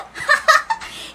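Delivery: high-pitched burst of laughter; high shrieking laugh. Real, not acted. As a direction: let out a loud shrieking giggle. Laughs at her own joke. (matinis na malakas na tawa)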